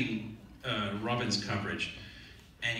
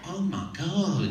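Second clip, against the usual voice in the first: higher, drawn-out mock dismay; nurse's dismay; 6 semitones higher, 4 dB louder, slower than usual. Nurse seeing the broken toe, high, slow mock dismay. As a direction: stretch it out in a raised nurse voice. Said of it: "she goes".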